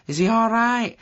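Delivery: high, loud North of England call; North of England accent. A narrator's reading. Lassie following an injured boy, North of England accent. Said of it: "in a North of England accent".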